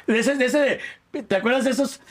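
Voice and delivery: normal talking voice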